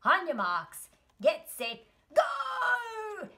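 building to a high, stretched "go"; race starter's call. Acted. Starter sends horse and snail off, rising shout. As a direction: call the start, soaring on the last word.

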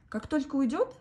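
plain reading voice

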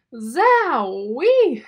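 loud, swooping cry of wonder; amazed exclamation. Acted. Ada reacts to the ketchup explosion, amazed and loud. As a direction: whoop it out, amazed.

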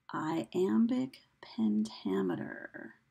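very slow teacherly enunciation of the term; slow sounding-out. Shown as a demonstration. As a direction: draw out "iambic pentameter" slowly, part by part.

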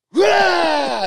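a huge high roar, full force; loud roar. Retold or imitated. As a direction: roar as hard as you can to burst out. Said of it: "as hard as I can".

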